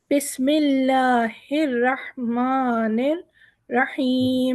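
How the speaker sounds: slow, drawn-out tajweed recitation; Quranic recitation. Shown as a demonstration. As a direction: recite slowly with full elongation. (धीमी तिलावत)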